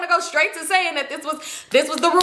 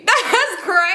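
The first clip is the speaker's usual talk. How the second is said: higher, louder, laughing it out; 7 semitones higher, 3 dB louder, slower than usual. Retold or imitated. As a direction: crack up in disbelief. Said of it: "And I'm just thinking".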